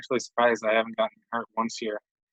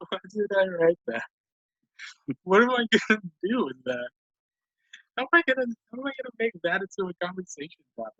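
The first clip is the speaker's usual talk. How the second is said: giggling through the words, higher; laughing; 8 semitones higher than usual. Real, not acted. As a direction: talk while laughing. Laughing at own old note.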